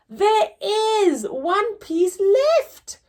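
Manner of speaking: high, slow, sweetly helpful. Acted. Laura helpfully pointing out the last slice, high and slow. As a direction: offer it brightly and slowly. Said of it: "in a helpful way".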